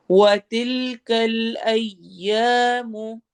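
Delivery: slow melodic tajweed chant; measured Quranic recitation. Shown as a demonstration. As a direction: recite slowly, stretching the long vowels. Recites verse phrase.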